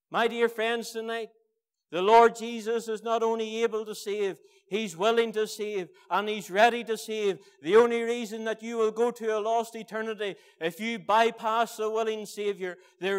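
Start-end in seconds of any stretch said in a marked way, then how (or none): none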